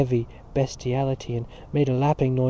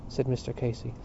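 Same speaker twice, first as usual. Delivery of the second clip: usual speaking voice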